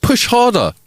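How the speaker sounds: high-pitched mock yell; retold shout. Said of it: "I shouted at my wife".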